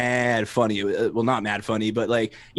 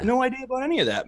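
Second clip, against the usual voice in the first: much higher and fast; mock clueless; 11 semitones higher, faster than usual. Mocking the clueless guy down the street, high and fast.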